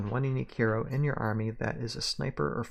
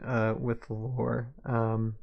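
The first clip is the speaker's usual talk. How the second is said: ordinary delivery, nothing marked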